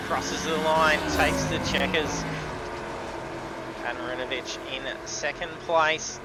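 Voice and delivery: loud, driven race call; race commentary. Real, not acted. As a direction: call the finish like a live broadcast.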